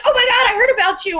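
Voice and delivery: high-pitched imitation of a gushing friend; excited friend. Friend meeting Doctor Jen, excitedly gushing.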